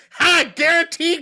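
high, loud, slow gloating sneer; mock gloating taunt. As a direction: taunt the league like a smug owner. Mocking owner gloats to league.

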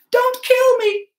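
very high, loud plea; terrified cry. A narrator's reading. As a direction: scream for mercy. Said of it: "I cried".

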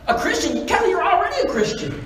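high-pitched, incredulous mother's challenge; indignant, high retelling of mom; upset mother. Retold or imitated. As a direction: act out an angry mom's disbelief. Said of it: "she's angry with me".

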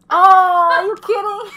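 loud, high startled outburst; jump-scared cry of disbelief; startled shout. Real, not acted. Startled by a game monster.

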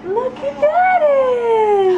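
high, loud delighted cry; excited. Real, not acted. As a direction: gasp it out, thrilled. Excited exclamation at baby.

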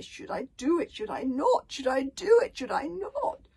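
loud, high, tormented muttering; awful muttering. A narrator's reading. Bodyguard torn over killing Hiccup, loud awful muttering. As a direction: torn over the deed, muttering loudly to himself. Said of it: "muttering to himself loudly in a voice that was awful to hear".

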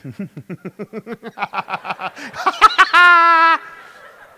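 imitating a gleeful maniac's long rising laugh; manic cackle. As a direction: giggle building to a high, loud cackle. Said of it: "is cackle".

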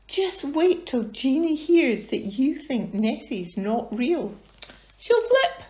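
high cheeky character voice; lightly voiced Angus. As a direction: relish the threat. Angus teasing in story.

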